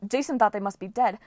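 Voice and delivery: ordinary delivery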